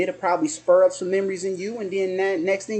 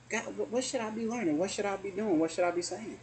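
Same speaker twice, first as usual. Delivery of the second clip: soft prayer; 2 semitones higher, 9 dB quieter than usual. Pretend. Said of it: "sit next to the plants maybe and say".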